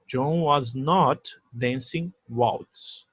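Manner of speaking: very slow, "not" clearly marked; stress on NOT. Shown as a demonstration. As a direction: hit "not" in a slow reading. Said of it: "eu acrescentei o not". (ênfase lenta em "not")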